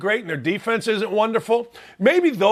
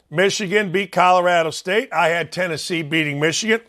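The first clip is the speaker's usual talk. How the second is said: usual speaking voice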